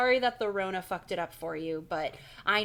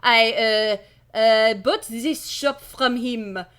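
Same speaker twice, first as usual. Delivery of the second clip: loud, slow hammed-up French accent; 3 semitones higher, 12 dB louder, much slower than usual. Shopkeeper explaining himself to the kids, hammy French accent. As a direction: play him as an exaggerated Frenchman. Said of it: "I've decided he's French".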